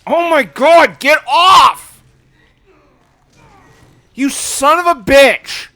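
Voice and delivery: high, loud panicked yelling; angry shout. Real, not acted. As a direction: yell at the attacker. Player panicking and raging at enemy.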